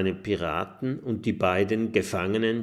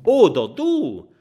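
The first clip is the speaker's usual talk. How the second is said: calling out; 8 semitones higher, 11 dB louder than usual. Acted. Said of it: "vor und ruft".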